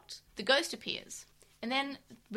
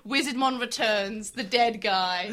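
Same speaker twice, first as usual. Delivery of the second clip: mock title announcer; 8 dB louder, slower than usual.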